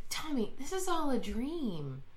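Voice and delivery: soft, intense confiding; hushed mock revelation. [name] tells Tommy it's a dream, hushed.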